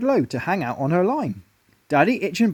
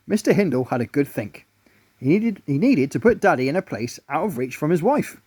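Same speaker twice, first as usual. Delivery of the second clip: ordinary delivery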